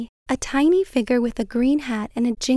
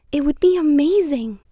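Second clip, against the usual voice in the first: put-on child voice; 3 semitones higher, 4 dB louder than usual. A narrator's reading. Boy voiced in a story.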